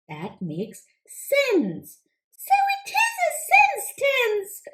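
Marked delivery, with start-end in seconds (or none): 2.4-4.7 high, quick little frog puppet, eager to answer; squeaky-high puppet frog pleased with itself; Froggy puppet voice. Acted. Frog puppet answering the teacher, high and eager.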